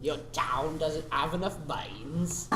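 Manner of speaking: slow, drawn-out broad Cockney; thick Cockney accent. Shown as a demonstration. Demonstrating film Cockney to co-host, thick Cockney accent. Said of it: "So that sort of thing".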